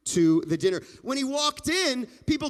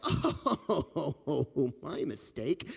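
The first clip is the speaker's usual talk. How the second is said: rueful laugh; 7 semitones lower, 3 dB quieter, much slower than usual. Acted. Rabbi realizes with a laugh.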